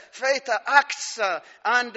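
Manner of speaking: normal talking voice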